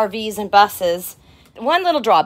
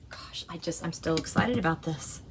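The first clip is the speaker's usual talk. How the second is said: breathy whisper; 5 semitones lower, 11 dB quieter than usual. Real, not acted. Overwhelmed sigh of excitement.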